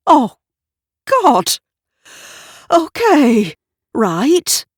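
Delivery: flustered high exclamations; rattled. A narrator's reading. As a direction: pull yourself together in a panic. Gavin shaken by the bottle.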